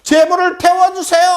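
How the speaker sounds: very high, acted desperate cry to Baal. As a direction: cry out to your god at the top of your range. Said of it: "화가 나서 더 크게". (아주 높은 소리로 부르짖는 기도)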